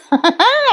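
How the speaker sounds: a quick high giggle; real laugh. Real, not acted. Reviewer giggling at Sarang's pinky promise, short high laugh. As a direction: laugh lightly, amused.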